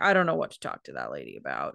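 a quick, deep, lightly voiced imitation of him; quoting the student. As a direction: drop way down and rattle it off like the exchange student. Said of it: "'Cause he's like".

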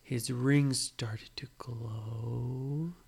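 slow breathy whisper of wonder; hushed whisper. Acted. Parent to child as the rings glow, whispering. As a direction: hush it like magic is happening. Magic moment in bedtime story.

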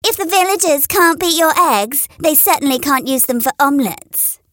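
high needling hen voice; high sly hen; tart hen retort. A narrator's reading. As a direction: score a point off her. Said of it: "Polly Plymouth Rock told Henrietta".

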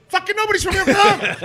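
very high, loud, laughing; triumphant verdict. Retold or imitated. Chef announcing result to friend, very high loud triumph. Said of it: "he goes".

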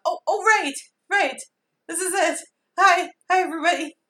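high, loud, flustered greeting; panicked excitement. Retold or imitated. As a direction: suddenly blurt out a panicked hello. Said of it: "I'm like".

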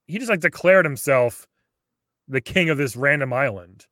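usual speaking voice

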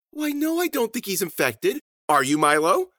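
high-pitched, airy chatter; high singsong questioning; dotty cave woman. Acted. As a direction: answer sweetly up high, then turn the question on the guest. Susie chats with skull, questions guest.

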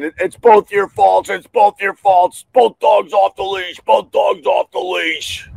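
angry dog owner shouting blame at a woman on the beach; retold yelling. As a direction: hammer the blame like an angry stranger. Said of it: "the guy's yelling".

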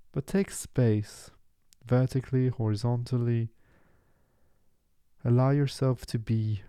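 calm, even meditation voice with long pauses; unhurried guiding, leave space after each short phrase; guided meditation. Real, not acted. Meditation guide settling listeners into the session, calm and measured.

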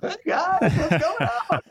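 high, loud, laughing fake-chipper hello; forced cheerful greeting. Comedian greeting grieving office workers, forced laughing cheer. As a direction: greet a devastated office as if nothing happened, laughing. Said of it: "I have to come in and be like".

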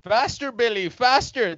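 high, eager cheering; excited urging. Acted. Urging on the bike.

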